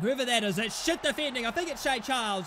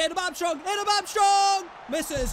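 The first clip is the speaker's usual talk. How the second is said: stretched, raised cry of excitement; excited shout; 3 semitones higher, 5 dB louder than usual. Real, not acted. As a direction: shout the name as the chance comes. Calling a near chance.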